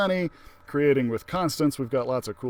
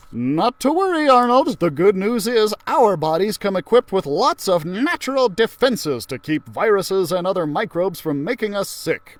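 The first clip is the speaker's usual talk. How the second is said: cheerful teacher voice; 6 semitones higher, 7 dB louder than usual. Acted. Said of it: "Miss Frizzle said".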